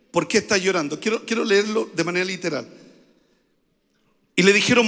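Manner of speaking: ordinary talk, nothing marked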